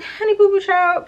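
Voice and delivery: very high-pitched, drawn-up sassy dismissal; sassy mock retort. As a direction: pitch it way up, mock-sweetly putting him in his place. Acts out her dismissive retort.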